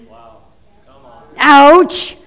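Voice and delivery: usual speaking voice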